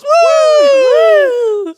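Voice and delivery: very high, loud sustained whoop; crowd woo. Retold or imitated. Everybody in the background joins in, high loud woo. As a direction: imitate a whole crowd cheering. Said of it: "everybody in the background goes".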